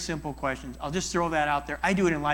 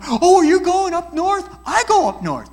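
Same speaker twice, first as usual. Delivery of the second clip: hammed-up, much higher and louder me-too; mock one-upping; 12 dB louder than usual. Listener turning the talk to himself, mock eagerness. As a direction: butt in eagerly to top them. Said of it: "Stop that".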